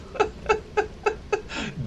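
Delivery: high, airy laugh fading out. Real, not acted. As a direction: breaks into laughter. Laughs after reading the note.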